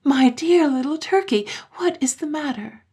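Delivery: high-pitched motherly concern. A narrator's reading. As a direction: exclaim anxiously to an upset child. Mother startled by child's tears.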